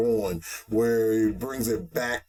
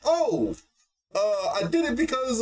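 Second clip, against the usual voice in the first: flustered Demiurge; 12 semitones higher than usual. Retold or imitated.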